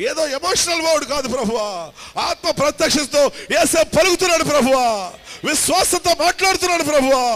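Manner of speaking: loud, high, impassioned intercession; fervent prayer. Acted. Pastor praying over the live audience, loud and fervent. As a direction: cry out to God with full force.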